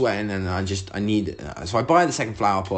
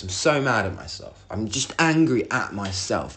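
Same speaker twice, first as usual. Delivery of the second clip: usual speaking voice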